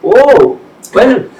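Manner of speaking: high, loud startled reaction; taken aback. Real, not acted. Host reacting to the guest's last raise, taken aback. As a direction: pull the story up short in disbelief. Reacting to the shockingly low cap.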